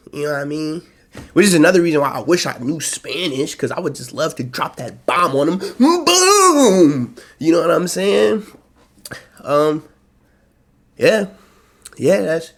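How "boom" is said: high, loud "Boom!" yell; excited shout. Pretend. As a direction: shout it out triumphantly. Dropping the bomb.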